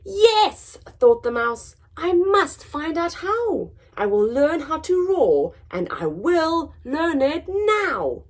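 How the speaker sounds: determined mouse. Acted. Mouse vows to learn to roar, high and determined. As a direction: fire yourself up.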